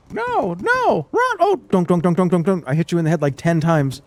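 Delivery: high, cartoonish mobile ad voice; mobile game ad voice. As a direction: ham it up like a cheap game ad. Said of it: "sounds like the audio from a mobile game ad".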